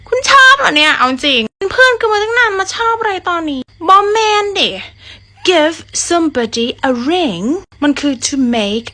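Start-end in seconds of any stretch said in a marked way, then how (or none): 0.1-4.7 mock flustered surprise. Flustered reaction retold. 5.5-7.4 slow, careful Give somebody a ring; idiom demo. Shown as a demonstration.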